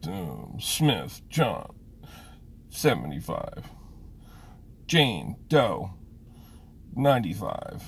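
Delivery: mocking a sulky boy reading out the names, whiny and dragged out; miserable whiny voice. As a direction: drag each name out like a kid who hates the chore. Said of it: "in this miserable voice".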